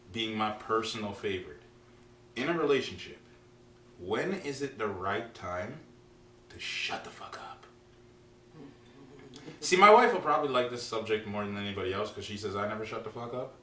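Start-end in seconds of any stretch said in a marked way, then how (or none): 6.5-7.7 hushed, whispered punchline. Real, not acted. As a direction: drop to a whisper for emphasis. Hushed punchline to topic intro.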